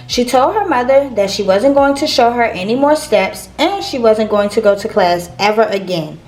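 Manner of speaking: usual speaking voice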